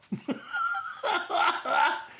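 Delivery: voiceless high laugh; breathy laugh. Real, not acted. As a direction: laugh silently through air. Laughing about fireworks.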